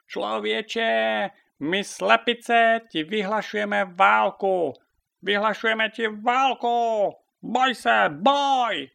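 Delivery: high, loud, drawn-out battle declaration; shouted proclamation. A narrator's reading. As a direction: call it out for everyone around to hear. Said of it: "volala na celé kolo".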